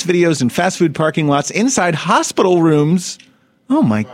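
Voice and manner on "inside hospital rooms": ordinary talk, nothing marked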